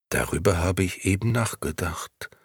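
plain reading voice

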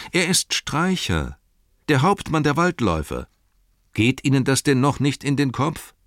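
high, pressing explanation; emphatic insistence. Acted. Hobbit spells out Streicher's identity to innkeeper, emphatic insistence.

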